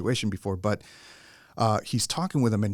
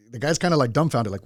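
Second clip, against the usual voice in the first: ordinary delivery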